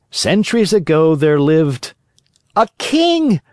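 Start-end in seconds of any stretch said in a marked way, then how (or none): 2.6-3.4 put-on children's guess. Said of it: "my little readers will say".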